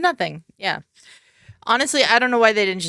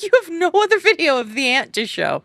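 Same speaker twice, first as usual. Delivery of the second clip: mock disbelief; 8 semitones higher, 3 dB louder than usual. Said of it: "And I was like".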